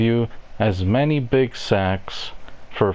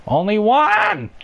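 put-on shrill girlfriend voice; 12 semitones higher, 3 dB louder than usual. Girlfriend demanding at counter.

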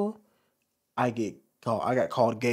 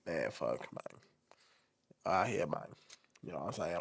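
much lower, softer mock tough-guy voice; hardened dude; 10 semitones lower, 16 dB quieter than usual. Mocking the hardened dude he's not, low tough-guy mumble. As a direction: sink the voice low like a hard street dude. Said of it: "I'm not some hardened dude like".

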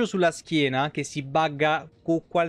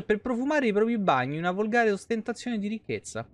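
brisk, higher read-aloud of a game codex; 4 semitones higher, faster than usual. Acted. Streamer reads the codex entry to chat, brisk narrator voice. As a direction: rattle through the lore entry like a narrator.

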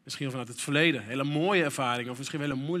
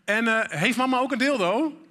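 put-on higher, louder innocent child's question; son's follow-up question; 6 semitones higher, 5 dB louder than usual. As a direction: blurt it out like a curious kid. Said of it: "kwam de tweede vraag". (nieuwsgierige zoon die hardop doorvraagt)

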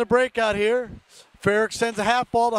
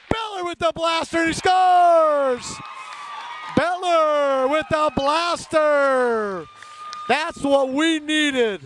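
long goal yell; 5 semitones higher, slower than usual. Real, not acted. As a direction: stretch every word out like a goal horn. Goal scored on power play.

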